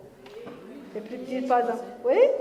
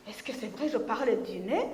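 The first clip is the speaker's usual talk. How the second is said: much softer, lower, wondering to herself; hurt self-doubt; 4 semitones lower, 8 dB quieter than usual. Retold or imitated. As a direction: ask it quietly, stung. Corinne se demande, blessée. (doute blessé, beaucoup plus doux et plus grave)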